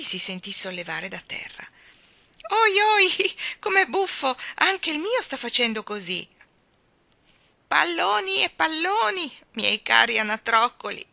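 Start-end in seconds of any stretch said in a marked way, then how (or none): 2.5-6.3 voce acuta e forte da bambino; eccitato. A narrator's reading. Michael sollevato da terra. 7.7-11.1 voce alta e squillante da vecchietta allegra; vecchietta gioconda. A narrator's reading. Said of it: "ripeté la vecchietta".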